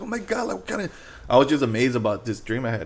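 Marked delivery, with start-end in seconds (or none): 0.0-0.9 very high, fairly soft mock crying; high-pitched retold tearful reaction; imitated crying mom. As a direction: imitate an overwhelmed mother starting to cry. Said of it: "she started crying, bro".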